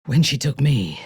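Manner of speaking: ordinary delivery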